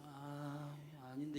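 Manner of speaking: sleepy groaning reluctance. Pretend. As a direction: sound like you can't get up. (이불 속에서 일어나기 싫은 졸린 중얼거림)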